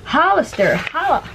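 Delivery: playful high. Real, not acted. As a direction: sing the brand name jokingly. Joking about the brand.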